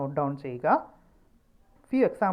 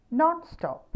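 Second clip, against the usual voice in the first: Non stop with Non hit high; stress on non; 12 semitones higher, 4 dB louder than usual. Shown as a demonstration. Teacher drilling Non stop for students, Non stressed. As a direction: start Non much higher, then drop. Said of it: "അവിടെ ഒരു stress ഉണ്ട്".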